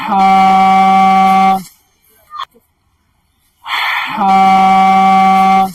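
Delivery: "ہا" from the throat, voiced and loud. Shown as a demonstration. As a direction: give voice to a full throaty ha. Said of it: "پھر اس کے بعد آواز نکالیے".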